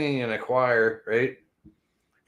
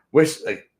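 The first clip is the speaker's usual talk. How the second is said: ordinary delivery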